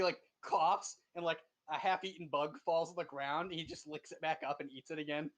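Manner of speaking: usual speaking voice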